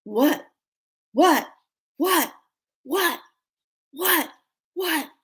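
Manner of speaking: high, yelped one-word questions; mock yelled "what". All the pranked men, mock yelping. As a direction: yelp each "what" separately, up high. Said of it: "just yelling out, "What?".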